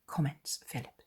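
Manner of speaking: ordinary delivery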